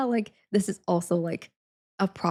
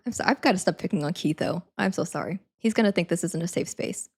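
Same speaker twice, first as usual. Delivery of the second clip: ordinary talk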